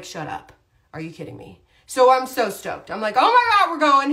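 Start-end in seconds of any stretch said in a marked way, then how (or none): 0.0-1.6 hushed, stunned and much softer; can't believe the good news, almost breathless; stunned disbelief. Retold or imitated. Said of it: "And I'm like". 3.2-4.1 much higher and louder burst of joy; thrilled. Retold or imitated. Retelling thrilled reaction to news.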